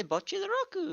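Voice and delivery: "Bocchi the Roku" with short open o and added final u; Japanese pronunciation. Retold or imitated. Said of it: "they say".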